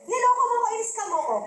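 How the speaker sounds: high mock-indignant accusation, falling at the end; acted complaint. Failed recruits blame whoever invited them, high indignant complaint. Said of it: "magre-reklamo sila sa nag-invite".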